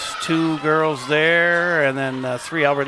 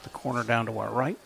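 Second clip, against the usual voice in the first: normal talking voice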